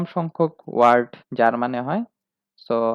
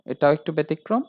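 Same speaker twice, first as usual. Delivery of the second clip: ordinary talk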